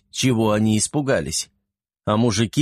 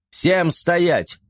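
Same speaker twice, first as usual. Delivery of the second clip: shout; 7 semitones higher than usual. A narrator's reading. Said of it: "перекрикивая всех, закричал".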